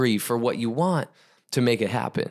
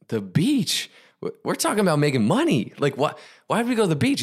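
high, incredulous questioning; acting out a baffled student; baffled protest; 5 semitones higher than usual. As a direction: question it in quick, high-pitched disbelief. Student baffled by guru's instruction.

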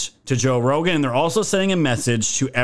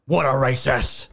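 mock outraged leftist. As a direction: sneer it as a hysterical critic. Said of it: "their foaming out the mouth lefty".

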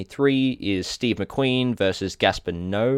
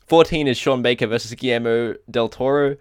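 ordinary talk, nothing marked

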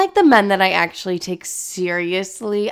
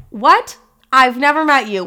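high, loud "What?" then indignant; genuinely thrown by the offer; shocked disbelief; 5 semitones higher, 6 dB louder than usual. Real, not acted. Incredulous reaction to Puerto Rico.

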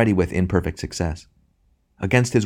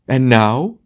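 high, loud despairing question; theatrical despair; 7 semitones higher, 6 dB louder than usual. A narrator's reading. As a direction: ham tragedian's stricken cry. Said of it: "with a gesture of despair".

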